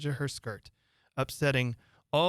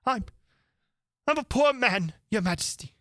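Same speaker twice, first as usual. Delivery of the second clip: frightened pleading; 9 semitones higher, 3 dB louder than usual. Acted. Hatter begging on one knee.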